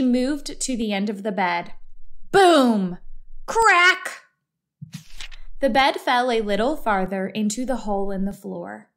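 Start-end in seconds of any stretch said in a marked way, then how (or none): 2.3-4.2 loud boom, high crack; crash sound effects. A narrator's reading. Narrator voicing the bed slipping further, loud crash words. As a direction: punch out the boom, then a higher crack. Crash in a read-aloud story.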